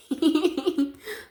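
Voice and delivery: a short, high, delighted laugh. Real, not acted. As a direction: laugh with glee at the cards. Laughs at good cards.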